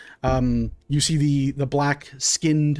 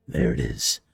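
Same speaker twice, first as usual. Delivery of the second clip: low monster growl; 14 semitones lower, 4 dB quieter than usual. Acted. Monster about to strike.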